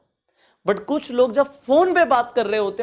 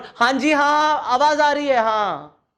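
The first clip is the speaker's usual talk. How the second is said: high, loud, laughing phone chatter; loud phone talk; 6 semitones higher, 4 dB louder, slower than usual. Shown as a demonstration. Coach mimics people yelling on the phone. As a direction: shout down the line as if it's bad. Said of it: "Not, इस तरीके से".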